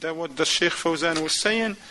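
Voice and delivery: ordinary talk, nothing marked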